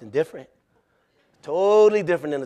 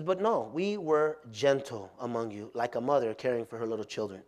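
gentle; 5 semitones lower, 7 dB quieter than usual. Acted. Reading gentle verse softly.

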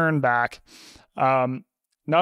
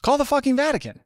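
much higher-pitched incredulous repeat; mock exasperation; 13 semitones higher than usual. Editor telling the intern the obvious, high and exasperated. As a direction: imitate an exasperated boss, voice jumping up high.

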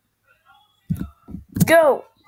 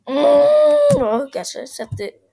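mock crying; 4 semitones higher, 6 dB louder, slower than usual. Losing, fake crying.

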